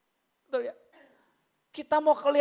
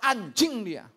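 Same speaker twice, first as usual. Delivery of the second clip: mock angry cursing; retold raging curse; 2 semitones lower, 4 dB louder, slower than usual. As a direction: imitate someone blowing up. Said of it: "Ngamuk dia".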